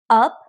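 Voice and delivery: up said with a rising pitch. Shown as a demonstration. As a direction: lift the voice on up.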